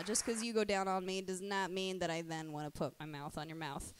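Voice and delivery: ordinary talk, nothing marked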